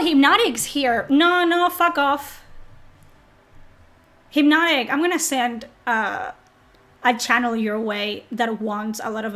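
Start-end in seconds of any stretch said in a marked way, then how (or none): none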